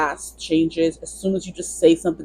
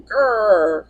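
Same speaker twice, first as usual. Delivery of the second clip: playful little "grr" of an upset tiger cub; mock tiger growl; 4 semitones higher, 4 dB louder than usual. Reader imitating Daniel Tiger gurring when upset, mock growl. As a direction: growl like a cartoon tiger child. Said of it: "he just keeps gurring".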